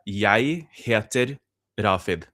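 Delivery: slow Oslo Norwegian, "Jeg" said "yai"; Norwegian phrase demo. Shown as a demonstration. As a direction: model "Jeg heter" word by word, "Jeg" as "yai". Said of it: "I'll say some phrases".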